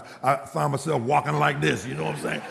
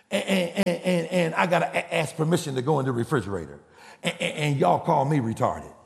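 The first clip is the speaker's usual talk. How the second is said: mimicked stammer, repeating first words; tripping over each opening word; stuttering. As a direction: stutter through an aggrieved complaint. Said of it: "you know he stuttered".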